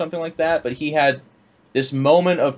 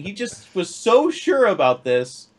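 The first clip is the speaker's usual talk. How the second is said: usual speaking voice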